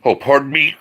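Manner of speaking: throat catching, voice strained mid-sentence; dry throat. Real, not acted. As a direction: speak through a dry, tickly throat. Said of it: "I'm choking up a little bit".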